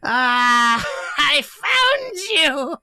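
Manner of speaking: very high, loud, slow gloating cry; crazed Santa. Acted. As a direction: gloat like a deranged Santa finding his prey. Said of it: "And he goes".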